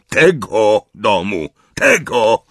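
loud high outraged shout; yelling. A narrator's reading. Uncle yelling at Harry about the house. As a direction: yell it in outrage. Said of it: "wrzasnął wuj Vernon".

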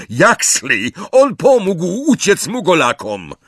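urgent high yell; shouted. A narrator's reading. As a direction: shout out the accusation for all to hear. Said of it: "i krzyknął".

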